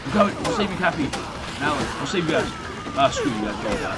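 loud frantic panic, running; being chased. Real, not acted. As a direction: yell as zombies close in. Fleeing zombie horde.

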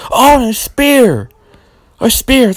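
high, drawn-out "Oh, and a spear!"; excited call. Acted. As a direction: sell the move like a hyped announcer. Calling the big move.

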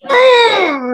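very high tense strangled cry; strained rage cry. Shown as a demonstration. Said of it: "And it's like".

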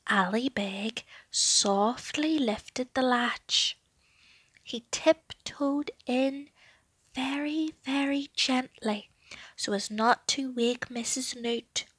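hushed and slow, sneaking; stealthy storyteller hush; tiptoe whisper. A narrator's reading. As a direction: whisper so nobody wakes.

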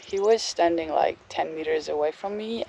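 ordinary talk

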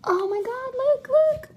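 usual speaking voice

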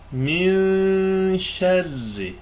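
slow Quranic Min şerri; drawn-out recitation. Shown as a demonstration. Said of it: "kimisi min".

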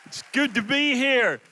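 loud, high, drawn-out hello to a crowd; real crowd-rousing shout; shouted greeting. Real, not acted. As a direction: holler a big stage greeting. Preacher greets the crowd on taking stage.

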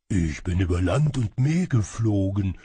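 put-on exhausted voice, still loud; weary, faint-spirited voice. A narrator's reading. Shadow servant returns from a long flight, weary. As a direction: announce it as if worn out. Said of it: "verkündete er mit matter Stimme".

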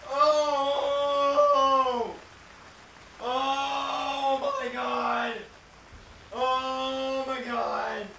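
high, long drawn-out moan; shaken fright. Real, not acted. Player reeling after a jump scare, frightened moaning. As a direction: moan each word out slowly, reeling.

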